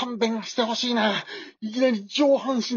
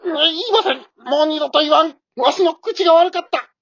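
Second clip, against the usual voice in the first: usual speaking voice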